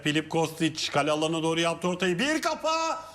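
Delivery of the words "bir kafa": excited shout. Real, not acted. Commentator calls a header.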